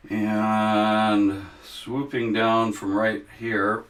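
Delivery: drawn-out swooping delivery; slow swooping drawl. Shown as a demonstration. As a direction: stretch the words like a brushstroke swooping down. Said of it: "Can you hear the swoop?".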